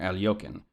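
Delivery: Alyokin as al-YOK-in; second pronunciation variant. Shown as a demonstration. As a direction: say Alyokin with 'yok' in the middle. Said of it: "any one of those three ways".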